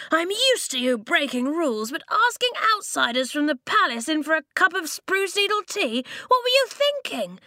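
rising outrage at a rule-breaker; scolding. A narrator's reading. Lofty scolding Flint for bringing an outsider, exasperated shout.

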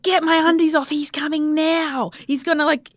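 high-pitched re-enacted panic; frantic urgency, retold. Labouring mother as baby is coming, retold frantic urgency.

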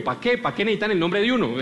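ordinary talk, nothing marked

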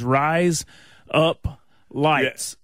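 Southern American drawl on the vowels; plain Southern US pronunciation; Southern accent. Shown as a demonstration. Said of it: "in my Southern accent".

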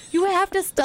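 high-pitched mock plea; imitated begging. Husband pleading with prankster wife, begging. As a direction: plead it like a husband at his limit. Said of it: "like, begging me".

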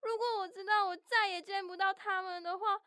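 acted crying while regretting a quarrel; tearful regret. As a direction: let the regret build into crying. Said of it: "别哭了".

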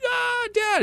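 very high squeal, imitating a small child; excited little daughter. Toddler daughter greeting dad at the door, squealing. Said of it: "And she's like".

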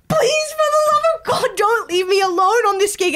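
very high retold desperate plea; panicking. Comedian messaging co-host who dropped out, high panicked begging. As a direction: plead in a hammed-up panic. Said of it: "panicking, being like".

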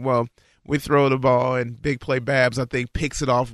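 normal talking voice

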